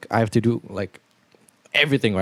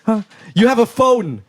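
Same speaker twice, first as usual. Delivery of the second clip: Andrew Tate manner; 8 semitones higher than usual. Pretend. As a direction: bark it out like a scornful alpha influencer. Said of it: "let's do it in the Andrew Tate manner".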